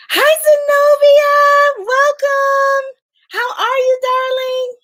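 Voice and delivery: high, slow singsong welcome; excited greeting. Real, not acted. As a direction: sing out a big hello to a friend. Greeting a viewer on livestream.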